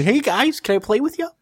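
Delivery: high, eager put-on voice; Argonian newcomer. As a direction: squeak it like a hopeful outsider asking in.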